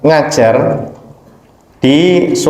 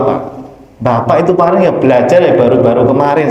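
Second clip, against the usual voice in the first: ordinary delivery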